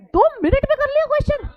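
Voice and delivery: high, loud mock astonishment; teasingly shocked question; mock disbelief. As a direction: pretend you can't believe it. Teacher mocking a claimed time.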